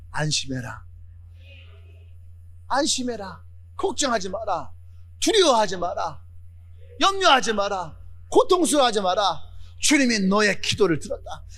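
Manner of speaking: calm him down gently like a comforter; gentle reassurance. Acted. Preacher voicing comforters to the blind man, soft and reassuring.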